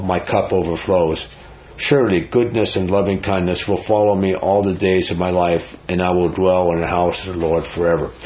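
low, measured reading of a psalm; scripture reading. Acted. Reads Psalm 23 aloud.

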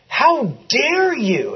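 high, drawn-out retold indignation; indignant outrage. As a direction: stretch it out, scandalized and reproachful. Retelling an indignant confrontation.

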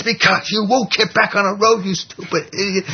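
high, raging retort to a stubborn animal; furious yell at the donkey. Retold or imitated. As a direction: spit it out like a man beside himself with rage. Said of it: "yells back at the donkey".

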